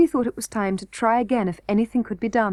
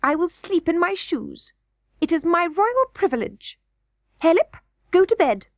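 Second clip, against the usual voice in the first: high, fussy goblin queen voice; a greedy queen eyeing fresh meat; 8 semitones higher than usual. A narrator's reading. Goblin queen to the king, high and fussy.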